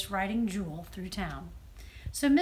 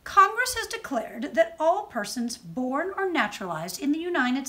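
raised, declaiming oratory; ringing tones; 4 semitones higher than usual. A narrator's reading. Missus Stanton addresses the inspectors, ringing declamation. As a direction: proclaim it like a speech to the room. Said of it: "spoke in ringing tones".

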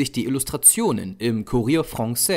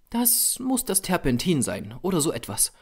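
higher female character voice, giving in; conceding; 7 semitones higher than usual. A narrator's reading. Model giving in to the painter, higher-voiced concession. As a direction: admit it in the model's raised pitch. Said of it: "gab sie zu".

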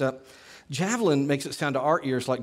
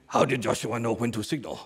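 a lightly voiced mock Sun Tzu, pitched lower; Sun Tzu imitation; 4 semitones lower than usual. Teacher voicing a student's question as Sun Tzu, lightly done lower mock general. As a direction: pose the question like a wise old general, gently. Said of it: "in your Sun Tzu imitation".

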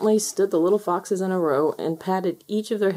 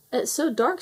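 small, higher-pitched fox voice; squeaky little fox; 7 semitones higher than usual. A narrator's reading. Little fox Rusty to the others out at night, squeaking. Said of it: "squeaked Rusty".

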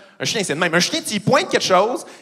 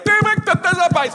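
high-pitched dumb agreement; imbecile spectators; 13 semitones higher than usual. Acted. Imbeciles in the audience taking sarcasm literally, caricature voice. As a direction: play a fool who takes it literally. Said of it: "les imbéciles étaient comme".

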